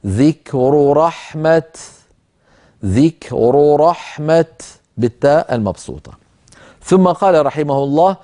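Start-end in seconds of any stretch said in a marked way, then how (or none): 0.0-1.6 very slow, رحمة stopped on its t. Shown as a demonstration. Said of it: "فنقف عليها اضطرارا أو اختبارا هكذا". 2.8-4.5 very slow, رحمة stopped on its t. Shown as a demonstration. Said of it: "فنقف عليها اضطرارا أو اختبارا هكذا".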